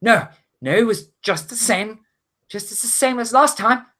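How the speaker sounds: high, worried deckhand; frightened female character in a high voice; shaken, afraid. Acted. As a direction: answer anxiously, repeating words. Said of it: "she looks pretty shaken and".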